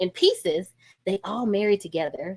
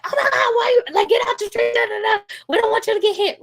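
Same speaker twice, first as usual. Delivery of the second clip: put-on high panicked yell at a kid in the street; frantic yelling; 4 dB louder than usual. Shown as a demonstration. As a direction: shout it in a panic, much higher and louder. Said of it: "there's this yelling".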